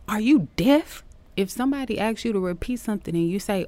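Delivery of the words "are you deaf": louder, higher mock shout of Are you deaf?. Teacher retelling Kayla's shout, mock shout. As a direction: imitate the girl shouting back, half in earnest. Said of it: "and then you shouted back".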